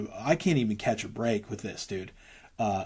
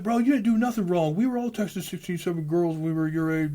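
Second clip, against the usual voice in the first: lightly voiced buddy, pitched much higher; a hint of a friend brushing it off, higher; reassuring bro; 8 semitones higher than usual. Old friend reassuring him on the phone, a lightly voiced higher bro. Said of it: "He's like".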